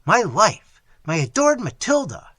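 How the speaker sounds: loud, high urgent plea; begging the friar to marry them, loud and high. Acted. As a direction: press the friar hard. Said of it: "continued Theodore".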